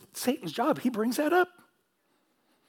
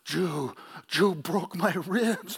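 lightly voiced old grandma in a slow Norwegian brogue, a touch louder; 4 dB louder, much slower than usual. Grandma with a Norwegian brogue blaming her grandson, slow. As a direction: drawl it out gently in a hint of a Norwegian accent. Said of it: "that Norwegian brogue".

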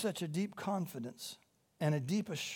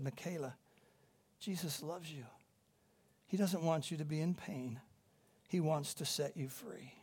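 gentle reassurance; 7 dB quieter than usual. Retold or imitated. Comforting a crying confused woman.

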